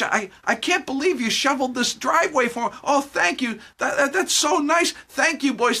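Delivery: put-on overwhelmed elderly man gushing thanks; grateful old neighbor. Old Mister Smith thanking the boys, high astonished gush. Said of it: "and he's like".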